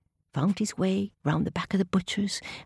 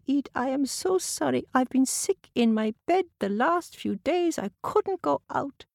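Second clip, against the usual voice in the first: put-on sweet old lady; 9 semitones higher than usual. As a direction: speak as a kind, sick old lady. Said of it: "sweet old lady".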